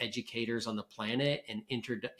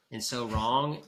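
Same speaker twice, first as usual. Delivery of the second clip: usual speaking voice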